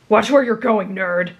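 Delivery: mildly irritated snap; snappish. A narrator's reading. Rival bumps the boy at the table, snapping. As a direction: snap with a touch of annoyance. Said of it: "he snapped".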